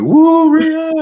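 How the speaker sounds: high, loud arena woo; fan cheer. Retold or imitated. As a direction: scream it like a fan in the crowd. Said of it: "We heard the".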